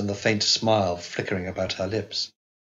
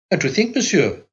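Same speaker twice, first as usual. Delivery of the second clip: high, triumphant exclamation; exclaiming nurse; 10 semitones higher, 4 dB louder than usual. A narrator's reading. Proud nurse announcing the baby, high and triumphant. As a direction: crow it out in triumph. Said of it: "she exclaimed".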